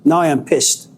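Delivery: usual speaking voice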